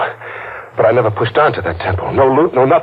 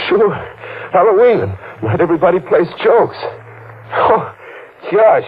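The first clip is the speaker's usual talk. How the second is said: much higher, rattled and jittery; nervous high pitch; 10 semitones higher than usual. Acted. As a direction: voice jumps up in nervous panic.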